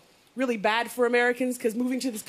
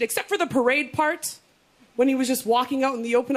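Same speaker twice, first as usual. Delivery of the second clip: ordinary delivery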